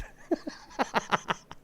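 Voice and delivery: high breathy burst of laughter. Real, not acted. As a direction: crack up at the joke. Laughing at the bandwidth joke.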